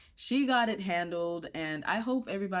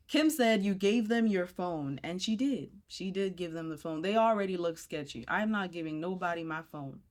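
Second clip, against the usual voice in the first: usual speaking voice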